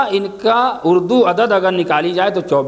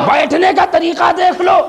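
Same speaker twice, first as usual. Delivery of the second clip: louder shout; 9 semitones higher, 3 dB louder than usual. Acted. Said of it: "ارے کیا کہنا".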